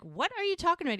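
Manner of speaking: high, loud retold disbelief; startled question. Jack hears about Portland, startled. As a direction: blurt it, pitched well up.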